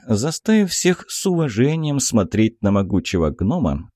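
ordinary delivery, nothing marked

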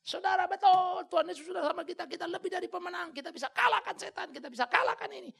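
high, loud mock victory cry; shrill mocking triumph; mocking triumphant believer. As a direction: ham up a cocky churchgoer's boast. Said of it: "Salah kau".

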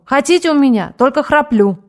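loud, as if to a deaf man; shouting. A narrator's reading. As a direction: bellow the offer. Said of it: "будто глухому".

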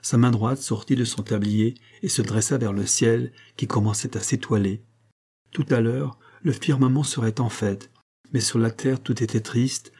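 ordinary delivery

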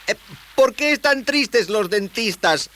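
put-on high, slow boyish question; high-pitched youngster setting up a joke; boy telling riddle.

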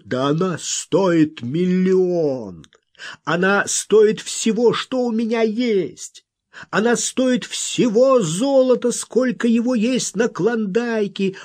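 high, slow, stretched declamation; impassioned eloquence. A narrator's reading. Wild man praising Miss Errol's worth, rhapsodizing. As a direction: proclaim it rapturously, pitch soaring. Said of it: "дал волю своему красноречию".

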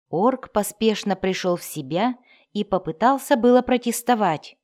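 plain reading voice

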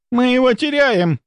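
very high, alarmed shout; panicked cry. A narrator's reading. Nurse shouts that the patient is slipping, panicked cry. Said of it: "кричала медсестра".

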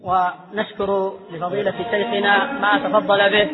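measured, solemn closing address; formal closing prayer. Acted. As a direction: close the session with a steady formal prayer.

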